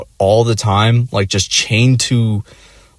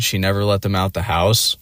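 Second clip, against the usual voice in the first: ordinary delivery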